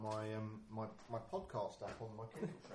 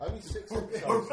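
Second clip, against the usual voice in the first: high laughing remark; 11 semitones higher, 13 dB louder than usual. Real, not acted. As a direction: tease through laughter. Laughing at own show.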